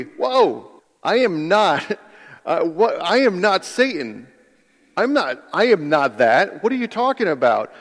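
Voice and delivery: high-pitched, taken-aback protest; stammered, startled objection; shocked denial. Acted. Helper answering being called Satan, shocked protest. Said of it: "your response would be".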